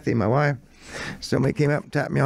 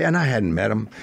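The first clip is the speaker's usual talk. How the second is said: usual speaking voice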